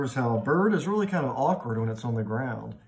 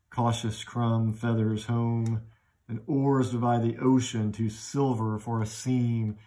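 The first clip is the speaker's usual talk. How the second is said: slow, flowing, euphonic verse; smooth, slow reading; slower than usual. Shown as a demonstration. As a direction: let the sounds glide slowly. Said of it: "sounds of the poem become smoother".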